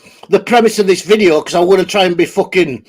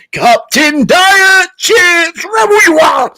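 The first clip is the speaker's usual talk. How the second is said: Captain Caveman voice; 7 semitones higher, much slower than usual. Retold or imitated. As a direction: bellow it like a cartoon caveman. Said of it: "That was Captain Caveman".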